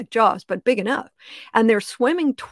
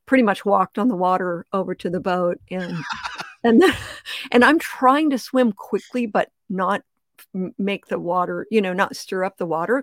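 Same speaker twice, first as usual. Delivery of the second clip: usual speaking voice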